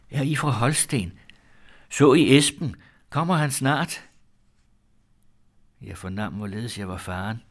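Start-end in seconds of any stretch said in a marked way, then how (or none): 0.1-4.1 high, loud, eager questions; eager questioning. A narrator's reading. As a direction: fire off eager questions, pitched well up. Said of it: "spurgte mig ivrigt". (ivrigt spørgende)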